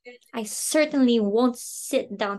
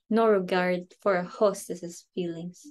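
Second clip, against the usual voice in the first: ordinary talk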